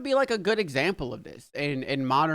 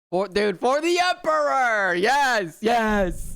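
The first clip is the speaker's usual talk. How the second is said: high, excited rallying cry; hyped battle cry; 6 semitones higher, 4 dB louder, slower than usual. Real, not acted. Fan cheering for the Emperor, hyped cry. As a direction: cry it out, stretched and rising high.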